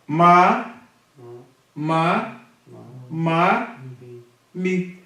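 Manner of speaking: slow, clear syllable drill, one by one; reading syllables aloud. Shown as a demonstration. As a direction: sound out each syllable for the student. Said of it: "Olha o desafio". (soletrando sílabas devagar e claramente)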